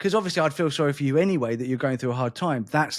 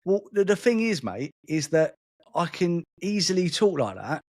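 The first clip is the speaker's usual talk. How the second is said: matey London match-day accent; 2 semitones higher than usual. Shown as a demonstration. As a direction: put on your old man's London accent. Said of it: "I started talking like that".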